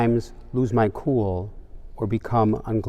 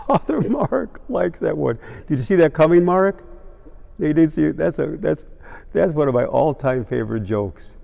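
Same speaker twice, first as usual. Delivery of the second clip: amused, laughing; 5 semitones higher than usual. Real, not acted. Still laughing after the punchline.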